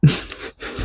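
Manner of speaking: quiet breathless laugh; breathy laugh. Real, not acted. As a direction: let a silent laugh escape. Laughs at own breakdown.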